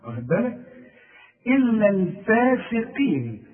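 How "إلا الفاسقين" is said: slow, stretch and thicken the ق in الفاسقين; heavy qaf. Shown as a demonstration. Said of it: "والقاف في آخر درجات التفخيم". (تفخيم القاف في الفاسقين)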